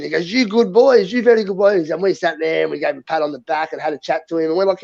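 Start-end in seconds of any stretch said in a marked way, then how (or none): 0.3-1.9 higher, approving mimicry of a Chinese man; imitated Chinese man. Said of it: "we had this little Chinese man walk up to us".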